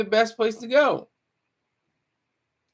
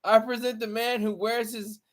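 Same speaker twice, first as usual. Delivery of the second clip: loud, higher showman introduction; announcer intro; 5 semitones higher, 3 dB louder than usual. Acted. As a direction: hype up the guest like a ring announcer. Mock announcer introducing a guest.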